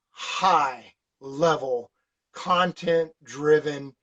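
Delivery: very slow, each word weighed; slow emphasis. Real, not acted. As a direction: lay it out slowly like a pitch. Drawn-out emphasis on selling point.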